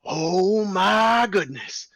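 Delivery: a fast-talking circus boss buttering up the bears; ringleader voice. Acted. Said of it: "he just stops and he goes".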